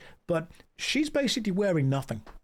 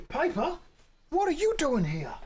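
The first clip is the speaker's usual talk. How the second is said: much higher, delighted greeting to the dog; 11 semitones higher than usual. Real, not acted. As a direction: squeal hello to the puppy.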